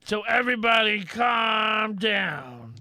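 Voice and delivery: shouting announcement. Acted. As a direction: preach calm to the passengers. Addressing crowd on train.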